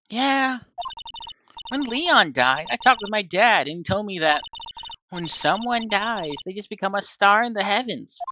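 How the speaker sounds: put-on girlish, earnest storytelling lilt; lightly voiced naive daughter. Daughter retelling dad's story of stars, lightly girlish. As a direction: lightly play an innocent girl explaining.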